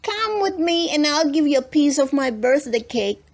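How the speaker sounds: put-on a child inviting her friend home; a hint of an excited little girl. A narrator's reading. Lulu voiced in story.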